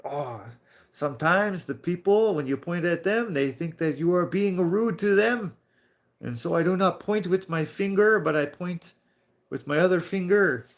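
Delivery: high, animated mock French accent. Coworker defends his pointing finger, imitated French accent. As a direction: imitate a French-speaking coworker justifying himself. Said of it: "but I am French".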